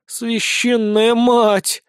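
high, long drawn-out lament; resigned exclamation. Acted. Blonde despairs at her comrades, resigned and drawn out. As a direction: exclaim in doomed exasperation, dragging it out. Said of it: "обречённо вздохнула".

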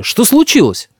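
high startled question; alarmed. A narrator's reading. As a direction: ask in a fluster as the grandfather. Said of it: "всполошился дедушка".

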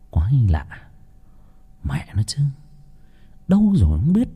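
puzzled whisper to himself; hushed, wondering whisper. Acted. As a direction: breathe it out, hidden in the dark. Father hiding, talks to himself.

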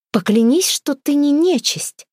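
demanding. A narrator's reading. Frightened girl to a stranger in the woods, demanding. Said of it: "потребовала она".

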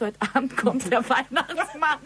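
mock herself with a laugh. Laughing at her own gullibility.

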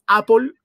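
APPLE said loudly as "Á-pol"; APPLE with a clear o and final l, punched out; Spanish pronunciation. Shown as a demonstration. Host shows how a Spaniard says it, pronunciation demo. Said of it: "como dice un español".

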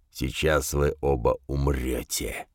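low, slow, soft death threat; rumbling demon voice. A narrator's reading. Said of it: "пророкотал он".

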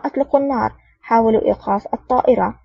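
ordinary delivery, nothing marked

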